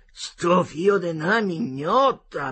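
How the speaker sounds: dazed oath after the blast; thought cursed. A narrator's reading. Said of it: "pensò Marcello".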